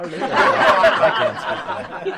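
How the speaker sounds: very high breathy giggle voice; squeaky laughing voice. Pretend. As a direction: squeak it out slowly while laughing.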